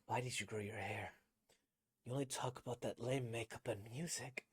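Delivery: soft, numb reproach; mind break. Shown as a demonstration. Voice actor performing the mind-broken character questioning their senpai, soft and broken. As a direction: hold it quiet and hollow. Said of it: "getting a mind break type thing".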